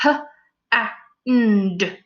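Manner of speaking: each sound said separately; sounding out letters. Shown as a demonstration. Teacher segmenting hand for pupils. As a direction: stretch it into its sounds. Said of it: "stretch the word slowly".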